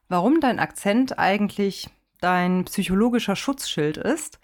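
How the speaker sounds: ordinary talk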